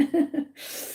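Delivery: short airy laugh, caught off guard; quick unvoiced laugh; breathy emotional laugh. Real, not acted. And I couldn't control it.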